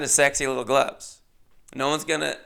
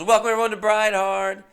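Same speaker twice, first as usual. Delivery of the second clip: host on the mic; 8 semitones higher, 5 dB louder than usual. Retold or imitated. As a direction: boom it out like a party host. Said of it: "And this woman goes".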